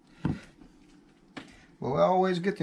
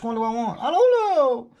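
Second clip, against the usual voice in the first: high, louder mock dither; playful high whine; 8 semitones higher, 6 dB louder than usual. Real, not acted. Indecisive over donuts.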